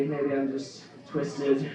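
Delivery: ordinary talk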